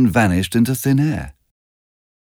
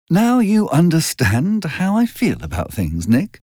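Daisy voice; 8 semitones higher than usual. A narrator's reading. Daisy finishes bitterly.

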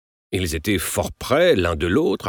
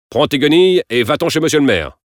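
louder, higher angry dismissal; brusque order; 7 semitones higher, 5 dB louder than usual. A narrator's reading. Father orders his son off to the mayor's, angrily. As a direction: send him packing.

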